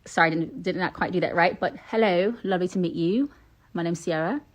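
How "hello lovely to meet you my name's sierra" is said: polite English accent greeting. Shown as a demonstration. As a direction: introduce yourself in a put-on English accent. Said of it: "with my English accent".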